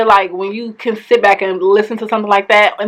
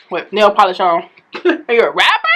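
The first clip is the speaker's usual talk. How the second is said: normal talking voice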